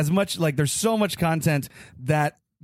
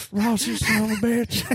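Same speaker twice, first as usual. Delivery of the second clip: put-on raised, lightly gruff old wrestler growl; Terry Funk; 4 semitones higher than usual. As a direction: spit it out like a grizzled brawler at his rival. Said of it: "Terry Funk be like".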